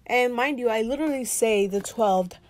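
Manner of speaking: loud, exasperated at herself; loud self-scolding. Real, not acted. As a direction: tell yourself off loudly, half laughing. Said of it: "Seriously, [name], what's going on".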